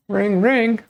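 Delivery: high, loud sing-song ring ring; phone ring imitation. Shown as a demonstration. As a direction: chime it out like a ringing phone. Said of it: "forgot how to ring ring".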